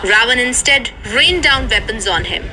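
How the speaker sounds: usual speaking voice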